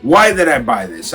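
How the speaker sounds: normal talking voice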